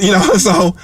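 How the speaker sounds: ordinary delivery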